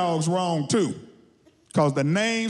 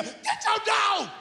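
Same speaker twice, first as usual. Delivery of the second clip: panicked yell; 14 semitones higher, 4 dB quieter than usual. Acted. Walker calling to owner of loose dog, high panicked yell. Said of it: "all I can do is yell".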